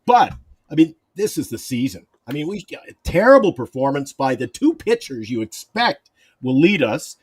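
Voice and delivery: ordinary talk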